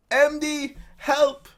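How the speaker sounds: high, loud call for help; desperate cry for help. Acted. Panicked figure calling to M D for help. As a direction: shout for help as the ground gives way.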